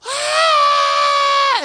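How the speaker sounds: sustained high shriek at full volume; imitated scream. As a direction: scream one long loud note. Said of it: "She let out this scream".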